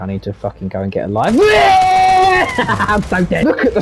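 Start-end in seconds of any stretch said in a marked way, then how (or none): 1.4-2.5 loud, high burst of laughter. Real, not acted. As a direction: crack up at yourself. Laughs at own rant.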